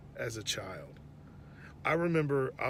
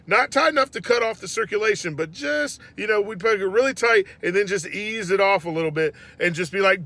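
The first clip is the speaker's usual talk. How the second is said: usual speaking voice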